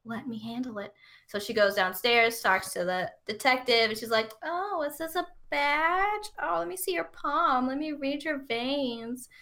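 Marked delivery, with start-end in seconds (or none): 0.0-0.9 soft, slow acted reassurance; imitating someone soothing a panicker; calm hushing. Jillian calming a panicking sister. 4.4-9.3 high, slow put-on coo; imitating a woman fussing over someone; cooing flirty act. Voicing Jillian cooing at detective.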